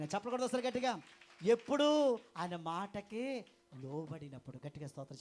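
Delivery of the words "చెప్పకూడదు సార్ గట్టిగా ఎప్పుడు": loud call. Real, not acted. Repeated call to congregation.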